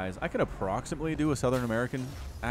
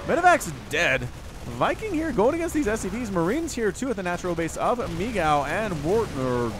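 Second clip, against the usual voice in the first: raised, louder live-match call; excited play-by-play; 6 semitones higher, 5 dB louder than usual. Real, not acted. Caster calls Vikings and Marines at the natural base, excited. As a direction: jump into a high, urgent call.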